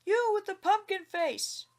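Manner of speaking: loud, high call across the yard; high, loud shout at the pumpkin face. A narrator's reading. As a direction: hail him sharply from a distance. Farmer calling out at stranger.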